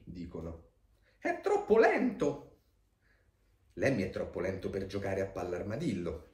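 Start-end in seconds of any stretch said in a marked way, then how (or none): 1.2-2.6 high, drawn-out complaint; complaining schoolmates. A narrator's reading. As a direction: stretch it out in high-pitched exasperation. Compagni che si lamentano di Lemmy. (lamentela acuta e strascicata)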